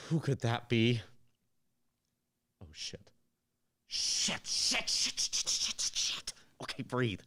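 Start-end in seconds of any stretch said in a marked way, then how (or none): 2.6-6.3 frantic whispered curses; panicked whisper. Acted. Guy panics when a knock comes at night, whispering. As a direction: whisper the panic under your breath.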